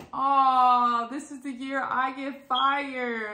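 loud, drawn-out mock lament; mock dismay. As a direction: wail it slowly in exaggerated dread. Retold dread of being fired.

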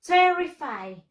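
emphatic teacher's model, "TER-ri-fide"; stress on first syllable. Shown as a demonstration. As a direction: push the TER in "terrified".

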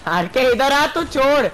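high, loud call to a teammate. Real, not acted. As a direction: yell for RK to come over. Calling teammate RK over.

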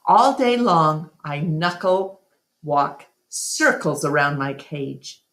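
ordinary delivery

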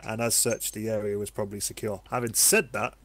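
ordinary talk, nothing marked

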